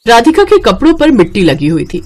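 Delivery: plain reading voice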